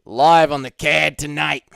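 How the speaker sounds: Boston-accented fight commentator impression; mock Boston broadcast call; John Anik. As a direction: announce it in an attempted Boston accent. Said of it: "My Boston accent is more of just a John Anik".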